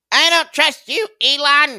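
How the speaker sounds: high, loud taunting voice aimed at Elon; mocking put-on voice. Acting out viewer's comment.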